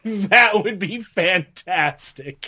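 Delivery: slow words through a real laugh. Real, not acted. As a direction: laugh it out, drawn slowly. Laughing at monster truck joke.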